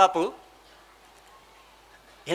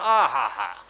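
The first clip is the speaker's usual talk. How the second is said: savoring food ahh; 7 semitones lower, much slower than usual. Retold or imitated. Sura Bapu relishing his food.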